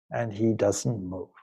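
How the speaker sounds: ordinary delivery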